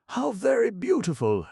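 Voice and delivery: wife marvelling at the sunset, awed exclamation; admiring exclamation. A narrator's reading. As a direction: gasp it out in awe. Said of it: "exclaimed Missus Spring Fragrance".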